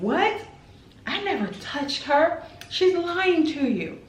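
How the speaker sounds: high, shocked denial, slow at end; startled dad denying. Retold or imitated.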